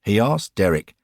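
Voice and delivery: ordinary delivery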